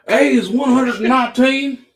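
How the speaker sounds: loud, high Southern twang on A is one hundred and nineteen; hammed-up Southern accent. Seller answering a viewer in a hammed-up Southern accent. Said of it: "Real Southern accent on that".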